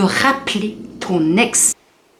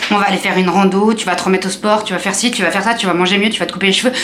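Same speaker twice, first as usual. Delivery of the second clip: ordinary delivery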